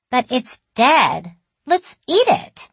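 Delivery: put-on high, loud, slow childlike voice; a little girl making an eager suggestion; matter-of-fact child. A narrator's reading. Child narrator proposes eating bird.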